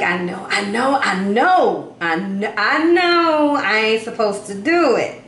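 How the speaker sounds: mock-exasperated inner voice, repeating itself; hammed-up, drawn-out reluctance; reluctant admission. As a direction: drag it out like someone who knows better. Acting out the viewer's reluctant thoughts.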